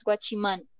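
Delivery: guachimán as Spanish gua-chi-MAN; pronunciación hispanizada. Shown as a demonstration. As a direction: say guachimán in three Spanish syllables. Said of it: "No se dice guachimán". (guachimán a la española, acento final)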